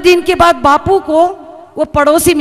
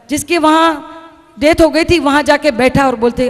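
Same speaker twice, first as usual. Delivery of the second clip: ordinary talk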